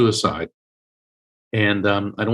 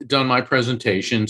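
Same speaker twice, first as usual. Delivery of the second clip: ordinary talk, nothing marked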